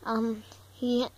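hesitant, quiet stutter. Acted. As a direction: stumble softly over the fillers. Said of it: "Why are you stuttering?".

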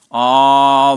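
put-on long, loud drawn-out "ah" of a child; little girl. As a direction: plead like a granddaughter with a big "ah". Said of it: "the little girl said".

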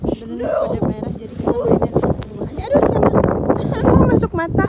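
high, loud shout into the gale; shouting over wind. Real, not acted. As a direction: yell to be heard over wind. Windy snowstorm, snow in eyes.

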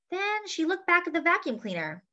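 ordinary delivery

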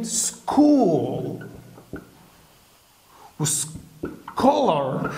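سكول and سكولر stretched very slowly with a hard k; slow sk sound. Shown as a demonstration. Teacher models the ch as k for students, very slowly. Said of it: "ال سي اتش هنا بتتنطق ك".

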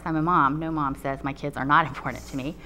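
ordinary talk

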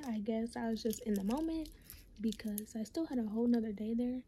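normal talking voice

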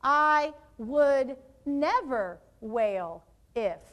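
very slow, one word at a time; deliberate dictation with long gaps; slow modelling. Shown as a demonstration. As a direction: separate every word for the class to copy. Models second sentence starter.

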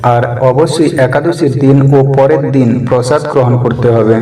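usual speaking voice

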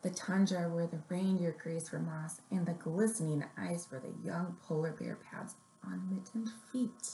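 gentle picture-book read-aloud; story reading. Acted. Librarian reading a picture book to little kids, gently.

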